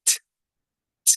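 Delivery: "t" as a bare voiceless tick; isolated tongue-tip bursts for each "t"; letter sound of T. Shown as a demonstration. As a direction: tap out "t" with no vowel. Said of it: "Aquí decimos".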